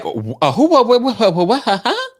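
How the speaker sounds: high, loud, sputtering confusion; flustered confusion. Retold or imitated. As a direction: stammer like you're caught off guard. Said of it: "L V P was like".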